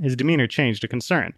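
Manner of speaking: plain reading voice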